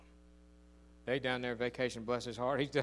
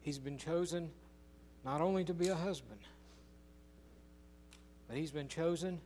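usual speaking voice

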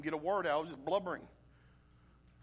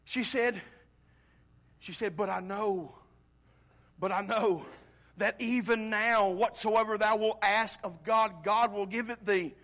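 fervent preaching; 5 semitones higher, 7 dB louder than usual. Acted. Preaching build, Martha quote.

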